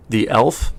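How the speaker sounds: normal talking voice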